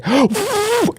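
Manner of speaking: high, exaggerated gasp; eager gasp. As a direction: gasp as if desperate to impress. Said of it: "trying to impress you with this expression".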